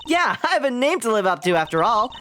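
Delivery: put-on high, eager young girl; upbeat girl voice. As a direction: cheer her partner on. Voicing the girl character.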